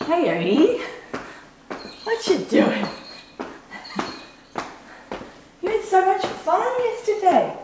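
high, sing-song pet talk; talking to the dog. Real, not acted.

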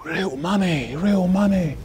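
put-on a touch of childlike wonder at cash; excited children. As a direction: play the thrilled little kids, mildly. Mimics neighbour's children earning money.

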